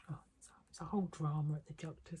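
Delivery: quick whispered aside, half to herself. Real, not acted. Telling viewers about the doctor's visit, in a quick whisper. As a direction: breathe it out fast as a secret.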